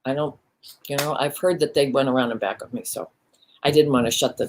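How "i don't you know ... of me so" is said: usual speaking voice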